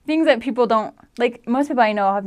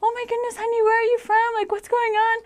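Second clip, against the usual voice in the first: worried stranger; 13 semitones higher than usual. Retold or imitated. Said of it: "They're like".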